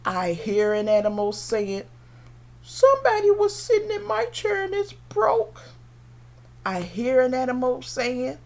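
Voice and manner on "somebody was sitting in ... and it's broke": high, squeaky little bear voice; baby bear's high-pitched complaint. Acted. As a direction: complain like a tiny storybook bear. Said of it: "The baby bear".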